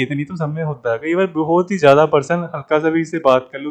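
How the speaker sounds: normal talking voice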